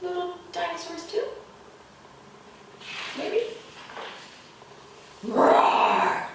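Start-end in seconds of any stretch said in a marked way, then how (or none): 5.2-6.3 loud, long playful roars; dinosaur roars. Acted. Dinosaurs roaring at the disguised kitties, loud roars. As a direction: roar big for the children.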